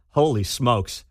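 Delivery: usual speaking voice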